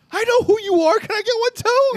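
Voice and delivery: high, loud squealing fan; high-pitched excited fangirl voice; excited fan girl. Retold or imitated.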